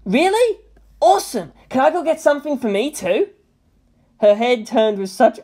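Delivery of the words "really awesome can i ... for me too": put-on excited boy. A narrator's reading. Boy excited about shopping.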